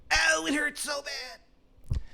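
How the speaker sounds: very high, slow drawn-out falsetto wail; Shithead Steve's slow wail. Acted. GM repeating dying Steve's cry, slow falsetto wail. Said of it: "And he's going like".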